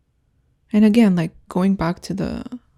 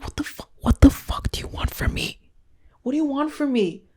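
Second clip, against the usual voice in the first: exasperated; 7 semitones higher than usual. Real, not acted. Exasperated rant at confusing men.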